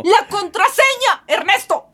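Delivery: high, slow furious demand; angry shout. Acted. Demanding the password in quarrel.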